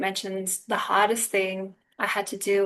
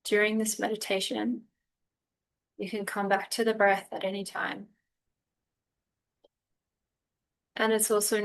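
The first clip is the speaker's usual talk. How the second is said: unhurried meditation guide with long pauses; calm, spacious guiding voice; guided meditation; 4 dB quieter than usual. Real, not acted. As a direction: ease them gently through breath and body, phrase by phrase.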